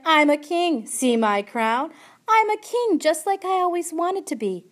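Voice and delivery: high, loud, gleeful mockery; mocking mirth. A narrator's reading. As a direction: crow like a pretend king. Uncle mockingly plays a king.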